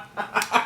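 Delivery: helpless cackle at own joke; real laugh. Real, not acted. Teller cracking up at the limp fall, laughing. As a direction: burst out laughing, wordless.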